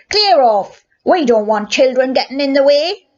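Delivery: loud, gruff order; policeman's yell. A narrator's reading. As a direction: bark the boy out of the way. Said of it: "he yelled".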